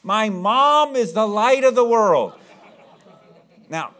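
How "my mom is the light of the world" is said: loud, higher, proud blurted shout. Retold or imitated. Little boy in the Sunday school program, proudly shouting his line. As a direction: belt it out triumphantly, a bit slow. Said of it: "he shouts out real loud".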